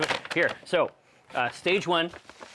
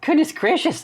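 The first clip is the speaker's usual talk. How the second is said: surprised exclamation; 12 semitones higher than usual. Real, not acted. Host exclaims at guest's arrival.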